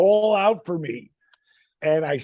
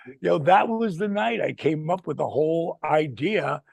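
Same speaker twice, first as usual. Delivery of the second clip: ordinary delivery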